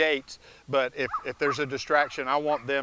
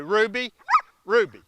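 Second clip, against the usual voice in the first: much higher and louder name call; sharp call; 11 semitones higher, 10 dB louder than usual. Real, not acted. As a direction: snap her attention back. Calling dog sharply.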